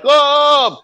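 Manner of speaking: high, loud "oh" of fright; mock-shriek in sudden shock; imitating someone scared off their seat; imitated startled scream. Imitates startled woman falling off chair.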